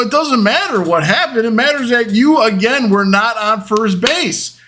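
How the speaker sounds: high, emphatic acted tirade; exasperated scolding. Shortstop scolding brother for leaving first base, exasperated. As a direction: hammer the point home with indignant stress.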